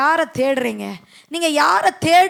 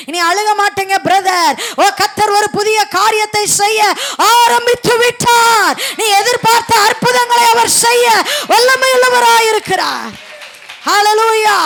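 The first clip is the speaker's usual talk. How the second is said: shouted proclamation; 7 semitones higher, 7 dB louder than usual. Real, not acted. Preacher encouraging weeping brothers and sisters, shouted proclamation. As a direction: proclaim the promise at the top of your voice.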